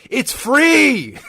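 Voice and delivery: high-pitched drawn-out yell; exasperated high shout. Retold or imitated. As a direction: yell it across the room, stretched out. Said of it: "the receptionist literally shouts".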